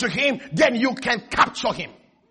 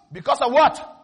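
ordinary talk, nothing marked

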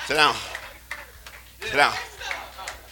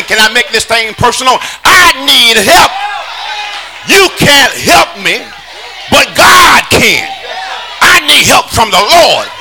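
fiery preaching; 4 semitones higher than usual. Acted. Preacher crying out need to the church, shouting. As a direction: build to a shout for the crowd.

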